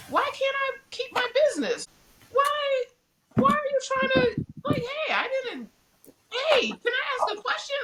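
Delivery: high, meek, pleading questions; high pleading voice, getting shouty; high timid pleading. Retold or imitated. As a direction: squeak it out like a frightened plea.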